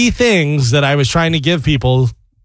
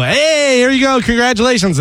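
cheerful greeting; 8 semitones higher than usual. Retold or imitated. Handing rum to bachelorettes, cheerful.

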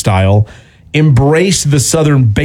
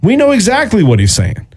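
excited; 9 semitones higher than usual. Real, not acted. Excited claim of understanding.

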